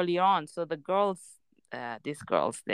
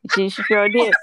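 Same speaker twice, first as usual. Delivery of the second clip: laughing in disbelief; 4 semitones higher, 8 dB louder than usual. Real, not acted. Laughing retelling daughter's sass.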